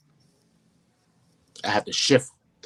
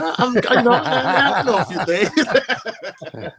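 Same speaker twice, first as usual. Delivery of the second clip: laughing modestly; 11 semitones higher, 4 dB louder, slower than usual. Real, not acted. Modest laugh after praise.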